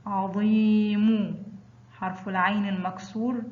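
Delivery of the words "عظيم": عظيم very slowly, ظي held long; drawn-out example word. Shown as a demonstration. Teacher showing pupils the long vowel, slow and stretched. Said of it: "ينطق ضي".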